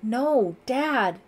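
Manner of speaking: high, loud protest; crying out. A narrator's reading. Daughter begs armed father to stop, crying out. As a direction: cry out to stop him. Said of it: "Sarah cried".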